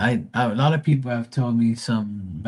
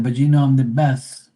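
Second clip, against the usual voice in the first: higher, louder self-praise; cocky loud boast; 5 semitones higher, 6 dB louder than usual. Retold or imitated. Bragging to his mom that he is her best child, loud and cocky. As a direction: brag loudly to win the point. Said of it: "I said".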